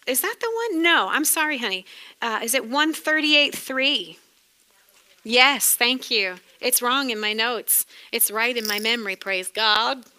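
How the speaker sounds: usual speaking voice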